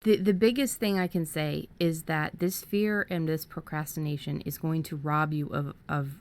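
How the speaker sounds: usual speaking voice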